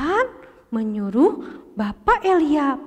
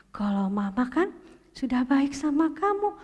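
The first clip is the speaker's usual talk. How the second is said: plain reading voice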